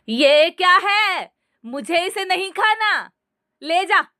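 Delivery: high, angry refusal, slow at first. A narrator's reading. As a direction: push the plate away in anger. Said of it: "सास गुस्से से बोली".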